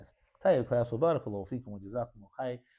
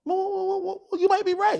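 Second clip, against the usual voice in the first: teasing; 6 dB louder than usual. Acted. Teacher teasing the student's answer.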